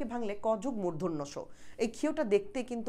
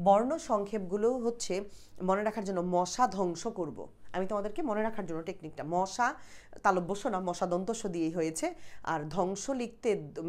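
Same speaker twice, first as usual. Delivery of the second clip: ordinary talk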